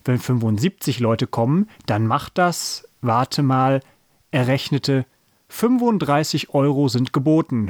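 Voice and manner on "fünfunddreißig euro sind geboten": plain reading voice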